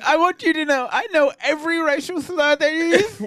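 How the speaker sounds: high, smug put-on voice; mock J K Rowling. Friends mocking the author, high smug voice. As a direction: play the author as a sneering bigot.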